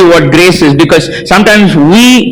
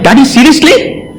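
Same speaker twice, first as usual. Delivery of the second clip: incredulous; 8 semitones higher than usual. Retold or imitated. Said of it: "So I'm saying".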